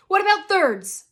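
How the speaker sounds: loud, high demand. A narrator's reading. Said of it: "Mason demanded".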